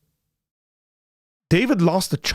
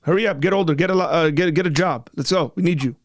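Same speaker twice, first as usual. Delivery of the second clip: higher-pitched, playful hustling orders; mock drill coach; 5 semitones higher, faster than usual. Host teasing a baby to get a job, mock drill coach. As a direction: rush them along in pretend impatience.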